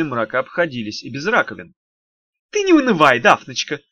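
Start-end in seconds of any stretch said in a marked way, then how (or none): 2.5-3.8 high imp voice bubbling up from a sink; gurgling from a drain. Acted. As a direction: gurgle it out of the pipe. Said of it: "пробулькал он оттуда". (булькающий голос из раковины)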